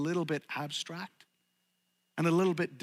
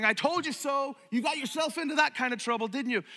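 scolding; 8 semitones higher than usual. Pretend. Scolding Jesus voiced mockingly.

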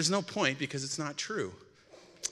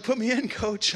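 eager, high and loud, ready to go; excited; 10 semitones higher, 6 dB louder than usual. Retold or imitated. Telling the pastor he wants to preach, thrilled. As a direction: jump at the chance like a kid. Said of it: "I'm all excited".

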